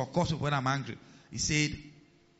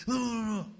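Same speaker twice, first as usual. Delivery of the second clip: higher-pitched mock angry babble; angry outburst; 7 semitones higher than usual. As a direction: sputter like someone too angry to think. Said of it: "when you are very angry".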